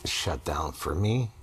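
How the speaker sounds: usual speaking voice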